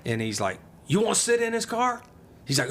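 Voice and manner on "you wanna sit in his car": put-on high, bright coaxing to a child; high coaxing offer. Raymond coaxing the little kid into the car, high and playful.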